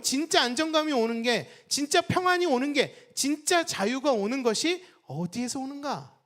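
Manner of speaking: rising, forceful sermon delivery; preaching. Acted. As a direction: drive home each line to the congregation.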